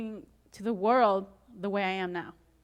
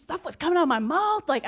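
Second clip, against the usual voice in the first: usual speaking voice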